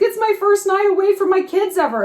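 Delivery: airy mocking impression of Blake Lively; a high, breathy put-on quote; quoting Blake Lively. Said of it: "how she's like".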